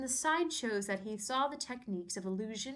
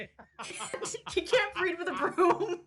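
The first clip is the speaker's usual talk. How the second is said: laughing; 7 semitones higher, 4 dB louder, much slower than usual. Real, not acted. Laughing joke line.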